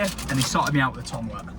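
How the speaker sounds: usual speaking voice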